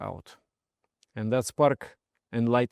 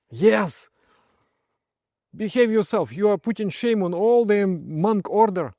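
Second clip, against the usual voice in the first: louder, higher stern rebuke; angry scolding; 8 semitones higher, 5 dB louder than usual. Retold or imitated. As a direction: tell him off for shaming the order. Friend scolding drunk monk.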